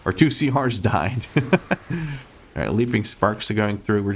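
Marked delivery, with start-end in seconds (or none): 1.3-2.2 high amused chuckle; short laugh. Real, not acted. Laughs at two deaths.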